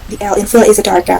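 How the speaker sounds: ordinary talk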